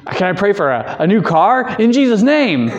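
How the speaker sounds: mock eager question, rising high; hammed-up wishful question; naive asking. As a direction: play the greedy believer hoping for a car. Mocking people asking for car.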